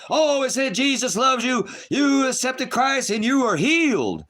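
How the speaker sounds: mock preacher. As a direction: parody a gushing believer proclaiming salvation. Mocking preachy believer.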